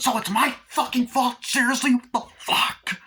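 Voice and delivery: high-pitched, loud indignant outburst; acted outrage. Bride blamed by her groom, acted outrage. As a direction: explode in imitated disbelief and fury.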